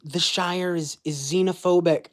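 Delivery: high, loud mocking voice of a critic; parroting a silly online comment, high and loud; mocking quote. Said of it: "some stupid comment being like".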